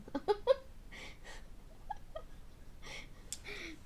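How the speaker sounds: laughing helplessly; high airy giggle; giggling. Real, not acted. Laughs at Russian-sounding attempt.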